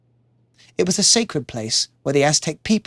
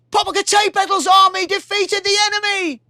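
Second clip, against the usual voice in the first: much higher, far-off messenger voice. Acted. Messenger reporting the battle to the king, formal. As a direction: announce it like a herald. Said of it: "said the messenger".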